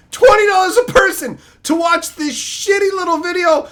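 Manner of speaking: "Twenty dollars a person" high-pitched, in one indignant run; indignant outburst. Real, not acted. Same price repeated in outrage.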